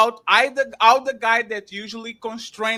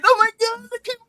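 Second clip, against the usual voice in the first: mock weepy woman; 12 semitones higher, 7 dB louder, faster than usual. Mocking woman crying over dog.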